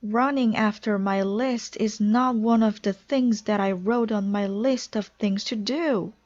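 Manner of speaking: high whining explanation; wailing complaint. A narrator's reading. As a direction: complain fretfully, near tears. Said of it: "wailed Toad".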